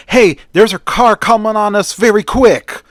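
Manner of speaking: high, loud shout to the driver; screamed warning. A narrator's reading. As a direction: yell out an urgent alarm over the danger. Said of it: "he screams to the driver".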